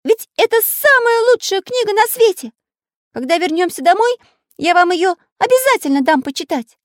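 very high, loud character voice; high-pitched scandalized praise; indignant Pencil. A narrator's reading. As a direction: insist hotly in a piping voice. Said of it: "возмутился Карандаш".

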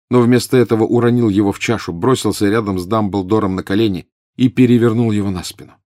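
ordinary delivery

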